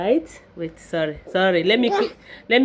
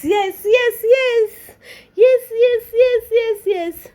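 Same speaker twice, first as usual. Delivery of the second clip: higher and louder excited yes; giddy, higher, louder yes yes yes; excited yeses; 12 semitones higher, 6 dB louder, slower than usual. Real, not acted. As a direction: bounce the yeses with joy.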